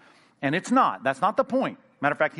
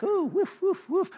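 much higher, puffed punch sounds; shadow boxing noises; slower than usual. Retold or imitated. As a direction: puff out air like throwing jabs at nothing.

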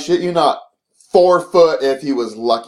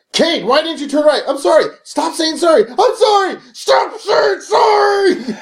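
high-pitched mock yelling, escalating; yelling dad; 14 semitones higher, slower than usual. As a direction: imitate an exasperated dad shouting, last words stretched out. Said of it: "he'll be yelling at you".

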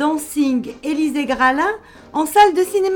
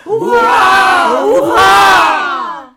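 loud triumphant hurrah; cheering shout; 4 semitones higher, 9 dB louder, much slower than usual. Acted. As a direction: cheer it out to the sky. Crowd cheering at launch.

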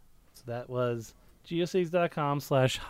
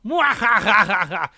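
loud, slow, higher villain laugh; evil cackle; 6 semitones higher, 8 dB louder, slower than usual. Acted. Villain laugh after Pez steps.

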